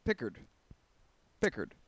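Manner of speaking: Pickard said PICK-ard, stress on first syllable; anglicized pronunciation. Shown as a demonstration. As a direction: Pickard with hard English R and D. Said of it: "That's how I always used to say it".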